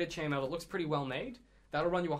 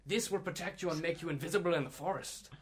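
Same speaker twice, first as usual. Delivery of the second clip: higher rustic sales patter; dwarf shop hand; 3 semitones higher than usual. Acted. Shop hand selling camouflage armor, keen dwarf voice. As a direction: praise the ware in a dwarf voice.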